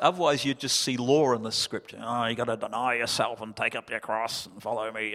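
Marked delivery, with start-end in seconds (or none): 2.0-5.1 low, soft, mopey mock voice; mock grudging tone. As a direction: whine it like a dreary duty. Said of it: "doesn't sound like much fun".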